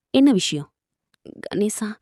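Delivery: normal talking voice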